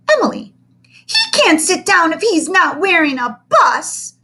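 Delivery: put-on high, loud tattling child; a bossy little girl telling on him; little girl protests. Girl protests to teacher, high and loud.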